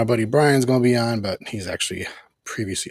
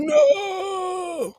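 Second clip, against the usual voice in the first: high, shouted cry of pain; anguished scream. Retold or imitated. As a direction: scream as if blasted. Retold Magneto scream when blasted.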